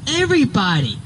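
high, falling retold comeback; exasperated retort. Answering a coworker's "Who?", exasperated. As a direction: throw the answer back high, then let it drop.

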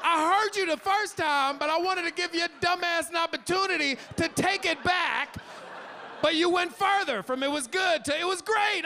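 mock angry rant. Dad ranting at lying son.

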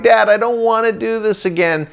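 put-on high, protesting kid complaint; acted-out sulky plea; whining refusal. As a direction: whine to your dad. Kid refusing his dad.

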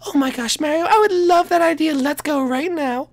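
high, sweet princess, a little snooty. Acted. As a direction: play a high-voiced princess asking teasingly. Daisy character voice.

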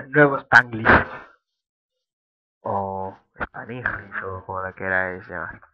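ordinary delivery

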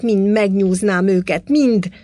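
loud, angry outburst; furious threat. A narrator's reading. Furious man threatening the whole lot, shouting angrily. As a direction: threaten them all with full force.

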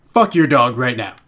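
usual speaking voice

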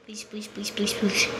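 rapid, hushed begging; whispered pleading. Real, not acted. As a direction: whisper it over and over like a prayer. Begging the game under breath.

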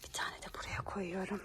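soft whisper while hiding snacks. Real, not acted. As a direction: secret whisper. Hiding snacks secretly for game.

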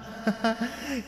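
put-on high soft eerie child's giggle; high soft ghostly giggle; creepy giggle. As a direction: giggle like a dead boy. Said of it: "he giggled".

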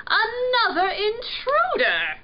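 high, loud royal exasperation; dismayed groan. A narrator's reading. Prince spotting another intruder, groaning. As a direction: wail it like a put-upon prince. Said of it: "Prince groaned".

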